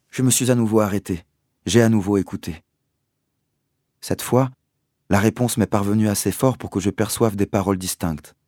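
ordinary delivery, nothing marked

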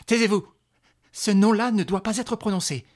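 loud, high, urgent order; forceful command. Acted. Mother forbids the woman that name, shouting. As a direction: shout her down.

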